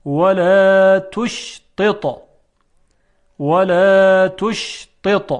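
slow, drawn-out ولا تشطط with two heavy ط; careful model of ولا تشطط; difficult ta drill. Shown as a demonstration. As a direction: recite ولا تشطط, long ولا and held ش. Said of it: "که تلفظ این هم مقداری مشکله".

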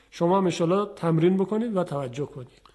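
ordinary talk

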